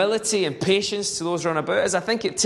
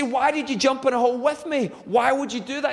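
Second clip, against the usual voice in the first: higher, baffled asking; can't believe he jumped in too; puzzled question; 7 semitones higher than usual. Retold or imitated. Man in the hole asks his friend why he jumped, higher baffled.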